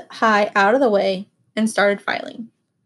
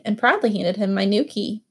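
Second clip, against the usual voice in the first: ordinary delivery, nothing marked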